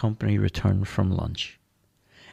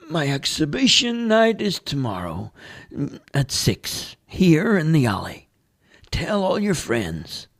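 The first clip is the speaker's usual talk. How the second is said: much higher, louder character voice; Dale's voice; 7 semitones higher, 3 dB louder than usual. Acted. Homeless Dale turning down the priest, high character voice. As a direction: play the street artist, eager and chatty. Dale announces his exhibition.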